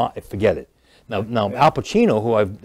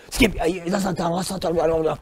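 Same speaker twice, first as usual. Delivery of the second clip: rushed lines; 7 semitones higher than usual. Retold or imitated. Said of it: "You're rushing your lines".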